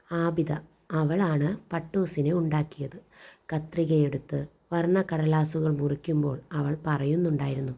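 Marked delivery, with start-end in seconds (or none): none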